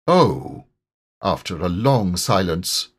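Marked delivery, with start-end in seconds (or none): 0.1-0.6 1 disappointed. Pretend. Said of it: "greatly disappointed".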